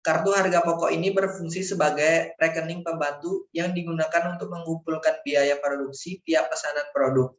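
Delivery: ordinary talk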